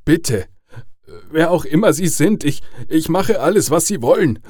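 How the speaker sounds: desperate high plea, building until it breaks; pleading, voice breaking. A narrator's reading. Father begs caller for his daughter, voice breaking. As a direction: beg loudly, then falter on a high last word. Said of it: "Seine Stimme brach".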